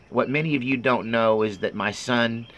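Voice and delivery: normal talking voice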